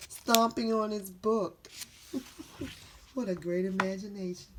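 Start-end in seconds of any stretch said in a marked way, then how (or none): none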